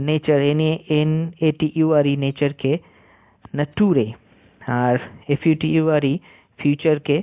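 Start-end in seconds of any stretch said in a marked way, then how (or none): 3.5-4.1 নাটুরে read letter by letter as na-tu-re; mocking wrong reading. Retelling a viral teacher's mistake, mock নাটুরে reading. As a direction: imitate a teacher who reads নাটুরে for nature. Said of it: "এরকম উচ্চারণ করছিল".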